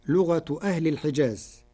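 ordinary delivery

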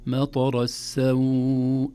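مطر السوء recited with a long-held "saw" glide; prolonged madd. Shown as a demonstration. Teacher showing the pause on السوء, ending prolonged. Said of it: "هذه هكذا مد".

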